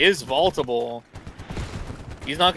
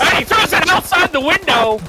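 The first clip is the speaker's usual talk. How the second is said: high, loud burst of excitement; excited shout; 7 dB louder than usual. Real, not acted. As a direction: yell it out as the play happens. Caster reacts to a grenade throw.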